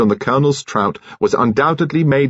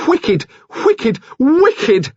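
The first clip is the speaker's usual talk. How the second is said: climbing ever higher and louder; growing shrill; 4 dB louder, much slower than usual. Acted. Furious nurse repeating the word, rising high and loud. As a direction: let the voice run up out of control. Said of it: "She heard herself grow shrill".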